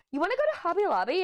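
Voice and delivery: high, fast mom impression. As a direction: rattle it off like an eager mom. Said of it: "she goes".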